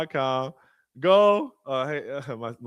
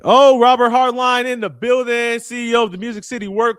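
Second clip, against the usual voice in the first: higher, louder hype announcement; hype shout-out; 10 semitones higher, 7 dB louder than usual. Real, not acted. Host announcing a guest speaker, hyped. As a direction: announce him like a hype man.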